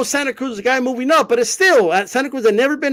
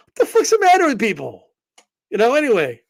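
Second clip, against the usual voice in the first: ordinary talk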